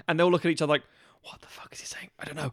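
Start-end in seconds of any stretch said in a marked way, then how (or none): 1.2-2.5 hushed confused whisper. Retold or imitated. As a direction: whisper it to each other. Crew whispering to each other.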